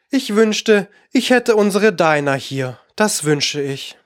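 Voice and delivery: ordinary delivery, nothing marked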